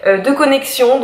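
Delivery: usual speaking voice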